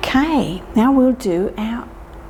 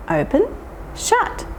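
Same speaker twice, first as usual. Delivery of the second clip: squeaked shut; 12 semitones higher than usual. Shown as a demonstration. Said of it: "can you do this".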